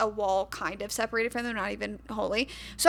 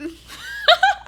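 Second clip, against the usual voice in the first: high burst of laughter; a big squealing laugh; 8 dB louder than usual. Real, not acted. Laughs heard.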